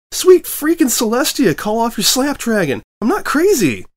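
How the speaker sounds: high, loud panicked cry; panicked yelp. Acted. Reporter cowering from the dragon, panicked high cry. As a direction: shriek for mercy from the dragon.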